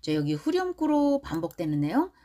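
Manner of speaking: ordinary delivery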